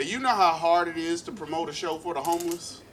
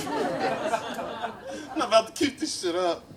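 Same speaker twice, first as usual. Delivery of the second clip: laughing through it; 9 semitones higher, 3 dB louder, slower than usual. Real, not acted. Laughing at own joke.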